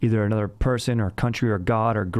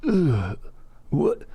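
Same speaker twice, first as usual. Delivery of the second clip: higher, halting grunts into a question; exaggerated actor's hesitant noises, pitched up; Brad Pitt impression; 10 semitones higher, much slower than usual. Shown as a demonstration. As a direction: warm up a movie-star impression with odd grunts. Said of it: "a Brad Pitt impression. It kinda starts with".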